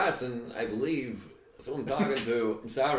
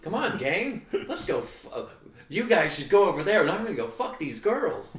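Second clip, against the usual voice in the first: Fred voice; 8 semitones higher than usual. Pretend. Scooby-Doo character riff.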